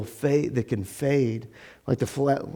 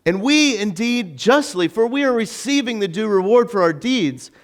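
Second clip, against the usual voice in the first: higher-pitched, earnest reproach; acted rebuke with raised pitch; rebuking; 6 semitones higher than usual. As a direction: reprove a fellow condemned man. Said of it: "the other one rebukes him".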